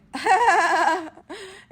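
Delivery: loud, high forced laugh; fake laugh. Acting amused for the date to hide panic, fake laugh. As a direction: burst into an over-loud put-on laugh. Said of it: "time to be an actor".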